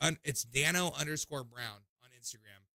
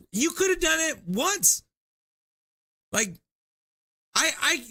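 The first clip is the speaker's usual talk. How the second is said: exasperated; 7 semitones higher, 7 dB louder, slower than usual. Real, not acted. Host exasperated after prank.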